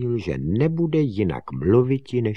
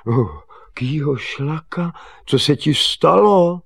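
high, raised astonished question; exclaiming wonder, building louder; feigned surprise; 7 semitones higher, 7 dB louder than usual. Acted. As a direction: act amazed as if you knew nothing. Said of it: "divil se".

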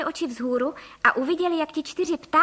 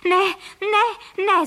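higher, louder panicked no; pleading shout of alarm; frightened cry; 8 semitones higher, 6 dB louder, much slower than usual. A narrator's reading. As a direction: cry out in fear at the guns. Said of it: "zvolali pan Frejka a paní Frejková současně".

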